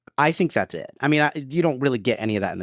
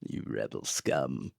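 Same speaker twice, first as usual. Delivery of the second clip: much lower, slow and softer; low gruff soldier; 12 semitones lower, 12 dB quieter, slower than usual. Retold or imitated. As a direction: growl it like a stormtrooper. Quoting movie line.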